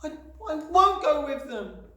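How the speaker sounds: put-on high, loud, slow child's voice; stammer, then refuse loudly; little girl's wailing refusal; defiant shout. A narrator's reading. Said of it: "Matilda shouted suddenly".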